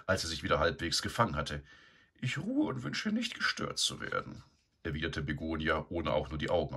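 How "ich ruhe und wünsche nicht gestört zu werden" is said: high, lofty dismissal; haughty sow. A narrator's reading. Begonia lying in his stall, haughty and high. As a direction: brush him off without looking.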